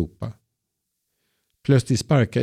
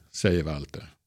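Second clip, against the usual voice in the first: ordinary delivery, nothing marked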